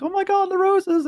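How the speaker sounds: high-pitched mock squeal of delight; imitating delighted girlfriend. Girlfriend finding the surprise roses, high imitated delight.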